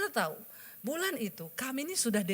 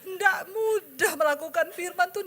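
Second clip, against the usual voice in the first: high, tearful cry, voice rising; 8 semitones higher, 3 dB louder than usual. Real, not acted. Telling the congregation obeying is hard, real crying. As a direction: let the tears break through.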